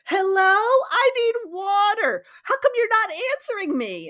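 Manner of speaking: mock a desperate survivor begging for water; whiny pleading character. Character begging silent ruins for water, mock tearful whine. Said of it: "And they'll be like".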